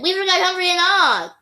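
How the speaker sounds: high, urgent exclamation; calling out. A narrator's reading. Child at the door remembering pets, urgent call.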